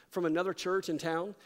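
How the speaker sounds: normal talking voice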